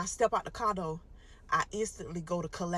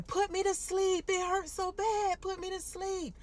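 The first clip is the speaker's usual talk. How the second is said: high, slow pained begging; crying patient begging doctors to put her under; crying plea; 12 semitones higher, slower than usual. Retold or imitated. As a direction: plead through the pain. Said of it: "I'm screaming, I'm crying".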